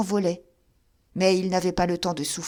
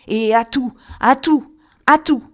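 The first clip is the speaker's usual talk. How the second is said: each repeat louder and higher to a scream; hammering threat rising to a scream; escalating shout; 5 semitones higher, 10 dB louder than usual. Acted. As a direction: climb to a yell.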